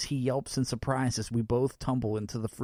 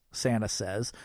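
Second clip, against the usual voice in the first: ordinary delivery, nothing marked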